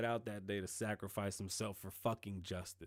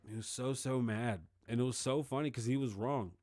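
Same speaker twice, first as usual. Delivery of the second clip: ordinary talk, nothing marked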